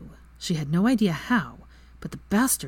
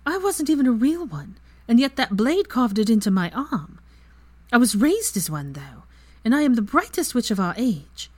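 high, loud, heated tirade; impassioned outburst; 6 semitones higher, 5 dB louder than usual. Acted. Hermione lecturing Lucius on her scar, loud and heated. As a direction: let her fury spill out at him.

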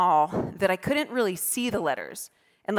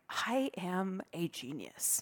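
soft, slow, to oneself; smug self-talk; 2 semitones lower, 15 dB quieter, much slower than usual. Retold or imitated. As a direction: hush out your own brilliance. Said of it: "being like".

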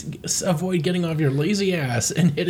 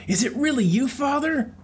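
Fox voice; 8 semitones higher than usual. Acted. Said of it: "And he's saying".

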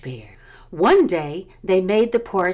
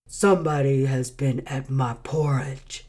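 great huge bear; 5 semitones lower than usual. A narrator's reading. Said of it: "in his great rough, gruff voice".

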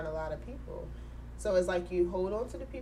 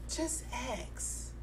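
soft advice; 4 semitones higher, 10 dB quieter than usual. Retold or imitated. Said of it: "Shannon is just like".

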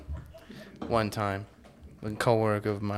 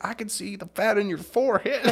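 high, laughing snipe; mock a catty comeback; catty retort; 10 semitones higher, 5 dB louder than usual. One roommate insults the other, laughing catty voice. Second lady retort quoted.

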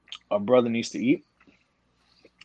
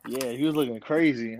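ordinary talk, nothing marked

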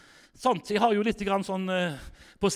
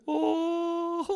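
mock emotional gasp; 11 semitones higher than usual. Shop lady starts crying.

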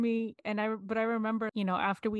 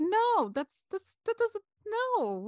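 higher, pleading no, no; exasperated protest; 12 semitones higher, slower than usual. Retold or imitated. Woman reenacting her protest at careless comfort. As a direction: protest in disbelief, voice climbing high. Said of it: "So I'm like".

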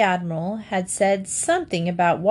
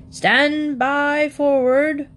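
higher, slow bellowed order; bellowing; 7 semitones higher, 3 dB louder, much slower than usual. A narrator's reading. Admiral calling orders to the front of the yacht, slow bellow. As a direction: bellow it down the deck, slowly. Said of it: "bellowed the Admiral".

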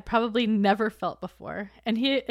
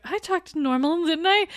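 anxious high squeak; 8 semitones higher, 4 dB louder than usual. Retold or imitated. Jane nervously asking if she talked normally, high and anxious. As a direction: voice climbing high, nervous and unsure. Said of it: "was like".

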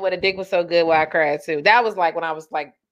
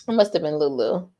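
ordinary delivery

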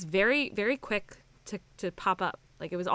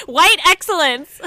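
very loud, high, drawn-out proclamation; gleeful shouted hype; hyped-up cheer; 8 semitones higher, 17 dB louder, slower than usual. Real, not acted. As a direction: belt it out slowly like a hype crew. Co-host hypes the favourite star.

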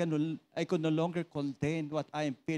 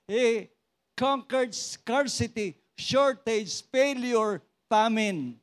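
slow declarations rising louder and higher; building preacher's proclamation; 4 semitones higher, 5 dB louder, slower than usual. Acted. Preacher declaring to the congregation, rising in force. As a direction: proclaim each victory, building to a climax.

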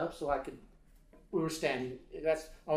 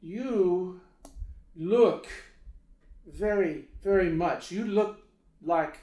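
put-on louder, higher, Lebanese-accented broken English; halting Lebanese accent; 4 semitones higher, 5 dB louder, much slower than usual. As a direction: imitate a solemn Lebanese man, long pauses between words. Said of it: "he says to me".

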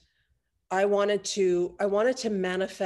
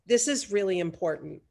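ordinary talk, nothing marked

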